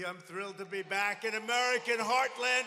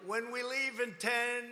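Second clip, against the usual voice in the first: usual speaking voice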